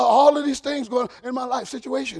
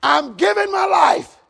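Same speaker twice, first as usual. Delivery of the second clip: high, drawn-out church declaration; loud declaration; 7 semitones higher, 6 dB louder, slower than usual. Shown as a demonstration. Preacher coaching a helper to tell the church, loud declaration. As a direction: lead the line out high and slow. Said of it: "I'll say it with you".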